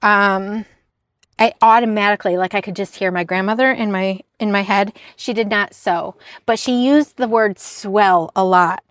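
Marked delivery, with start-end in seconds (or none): none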